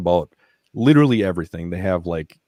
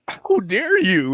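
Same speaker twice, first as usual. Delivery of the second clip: much higher, slow, whimpering protest; mock sobbing; 13 semitones higher, 4 dB louder, much slower than usual. Veteran crying at the therapist, slow high mock sob. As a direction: wail it out as if caught out. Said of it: "And I'm bawling".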